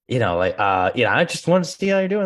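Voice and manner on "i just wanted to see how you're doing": much higher, forced-polite check-in; awkward phone call. Retold or imitated. Agent imitating his own awkward check-in, much higher forced cheer. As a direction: sound forced and a bit too sweet. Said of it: "and I'm just like".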